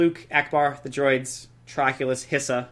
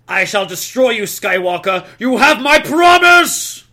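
building to a huge villain roar; menacing threat rising to a shout; 7 semitones higher, 10 dB louder, slower than usual. Acted. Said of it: "the Imperial leader's dire threat".

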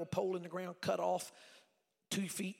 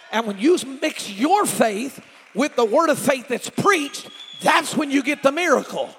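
loud, higher, exhorting sermon delivery; full-force preaching; 5 semitones higher, 17 dB louder than usual. Real, not acted. Preacher urging congregation to respond, full-force exhortation. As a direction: drive each phrase out to rouse the congregation.

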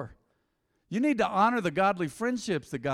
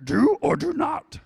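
Yoda; 8 semitones higher, slower than usual. Retold or imitated. Preacher quoting Yoda to the congregation, slow sage voice. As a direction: mimic the old sage, slowly. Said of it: "I heard Yoda say".

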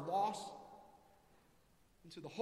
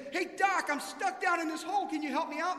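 much higher and louder, calling up out of the hole; yelling up; 10 semitones higher, 9 dB louder than usual. Acted. Man in a hole yells up to the doctor: much higher, louder call. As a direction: shout up to the passing doctor for help. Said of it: "the guy yells up".

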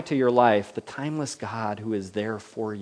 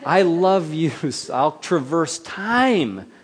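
loud, slow, higher proclamation of love; God's emphatic declaration; 6 semitones higher, 7 dB louder, slower than usual. Retold or imitated. God telling us he'll cross time, called out loud. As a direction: call it out, stretching traverse time. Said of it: "God says".

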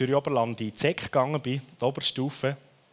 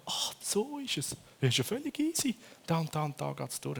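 mock relief; 4 semitones higher than usual. Relief when the light goes on.

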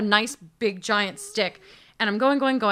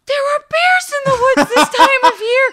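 very high, loud, alarmed old-lady impression; imitated little lady shrieking in alarm; high-pitched little voice; 10 dB louder than usual. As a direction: squeal the warning in a tiny shocked voice. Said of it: "she has this high-pitched little voice".

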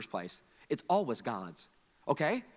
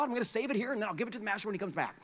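rushed servant; 6 semitones higher, 5 dB quieter, much faster than usual. Acted. Servant's anxious thought.

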